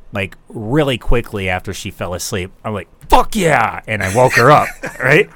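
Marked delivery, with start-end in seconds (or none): 3.1-3.8 a mock cheer, a bit louder; cheering a hockey goal; retold cheer. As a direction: celebrate the goal, half-held back.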